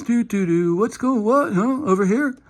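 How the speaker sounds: high, dazed mock confusion; confused player. [name] confused by the yelling, high dazed mock. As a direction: play the lost teammate who doesn't get it.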